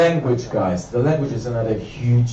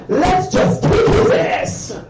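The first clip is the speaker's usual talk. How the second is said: bad cop mom; 3 dB louder, much slower than usual.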